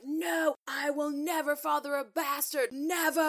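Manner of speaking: high, hammed-up vow of outrage; over-dramatic teen. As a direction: declaim it like a wounded teenager storming off. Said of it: "you being a little dramatic".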